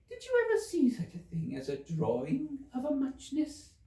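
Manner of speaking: high, drowsy little Dormouse; sleepy Dormouse. Acted. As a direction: drift toward sleep in a high small voice. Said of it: "woke up again with a little shriek and went on".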